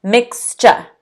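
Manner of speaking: "mixture" in two beats, strong then weak; first-syllable stress. Shown as a demonstration. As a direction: hit the MIX in "mixture". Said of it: "the stress is on the first syllable".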